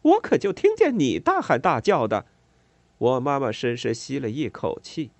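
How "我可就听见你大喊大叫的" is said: sarcastic retort. A narrator's reading.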